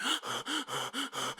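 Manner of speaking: a sudden, high, quiet gasp; sharp gasp. Acted. Narrator gasping at a woman running in the street, sharp gasp. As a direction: catch your breath in alarm.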